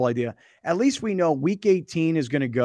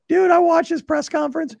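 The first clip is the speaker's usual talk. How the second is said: excited; 6 semitones higher, 4 dB louder than usual. Real, not acted. Retelling an emotional moment.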